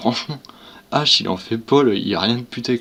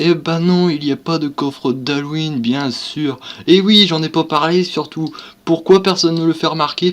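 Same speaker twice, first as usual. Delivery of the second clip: higher, louder hammed-up hype voice, sarcastic; mock clickbait youtuber voice; 5 semitones higher, 3 dB louder than usual. Mocking clickbait youtubers to his viewers, hammed-up hype voice. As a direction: mimic a hyped clickbait youtuber, tongue in cheek. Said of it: "je prends cette voix en fait, c'est pas ma voix ça".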